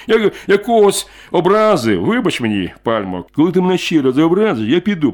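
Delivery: loud, high threatening warning. Acted. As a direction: warn him he will walk out. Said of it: "погрозливо сказав чоловік".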